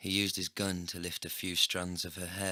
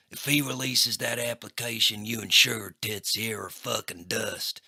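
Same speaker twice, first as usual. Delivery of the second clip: loud snarled threat; snarling threat; 3 semitones higher, 8 dB louder than usual. A narrator's reading. Crackjaw dragging the narrator, loud snarled threat. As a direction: spit the threat at him.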